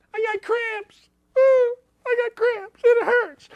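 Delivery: very high, far mock whimper; hammed-up crying whine; squeaky fake sob; mock crybaby.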